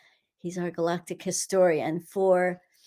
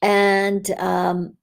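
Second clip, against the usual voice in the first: guided meditation. Acted. Healer guiding listeners through a meditation, calm and steady. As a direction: lead the group gently through the energy work. Leads a curse-clearing meditation.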